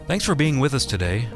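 ordinary talk, nothing marked

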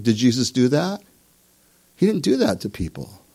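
usual speaking voice

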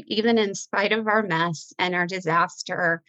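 usual speaking voice

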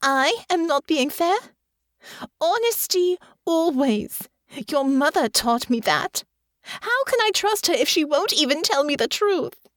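high-pitched outraged retort; hurt, incredulous fury; indignant anger. Acted. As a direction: throw the accusation back, pitch way up. Enraged character turns on Alex.

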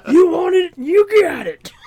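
loud, high mock-angry holler; mad kid. Imitating his mad childhood buddy, high angry yell. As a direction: holler the slogan like a furious child. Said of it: "he'd get mad and run off".

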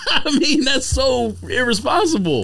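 laughing. Real, not acted. Laughing at a shoe name.

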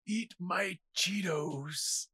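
hammed-up final breath, drawn out; mock dying last words. Acted deathbed last words.

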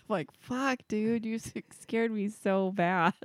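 ordinary delivery

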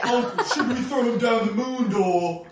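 high-pitched childish mock voice; high laughing kid voice. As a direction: squeak it like a weird kid.

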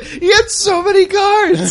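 high, drawn-out wail; mock lament. Player mourns Andy's death, high mock lament. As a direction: bemoan it in a slow, high cry.

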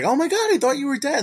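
high, bursting retold gasp of relief; hammed-up high-pitched shock; mock shock. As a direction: play it like she's stunned he's alive.

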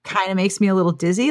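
ordinary delivery

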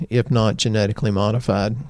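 ordinary delivery

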